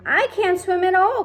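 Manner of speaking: very high, loud panicked cry; terrified confession; cried. A narrator's reading. A friend fears drowning, panicked cry. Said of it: "cried the".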